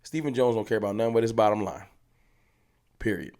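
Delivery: ordinary talk, nothing marked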